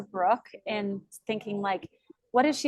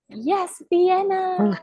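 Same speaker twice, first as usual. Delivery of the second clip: bright higher call, louder; excited call-out; 8 semitones higher, 5 dB louder, much slower than usual. Real, not acted. Host on the group call excitedly calling on Vienna. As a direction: call her name out eagerly. Host calls on next speaker.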